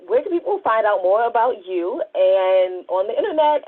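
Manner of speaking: normal talking voice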